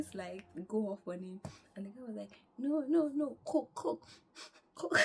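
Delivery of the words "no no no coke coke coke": high, loud, mock-panicked insistence; frantic correction. The man correcting what was misheard, frantic. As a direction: hurry to clear it up, repeating the word.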